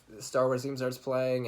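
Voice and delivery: normal talking voice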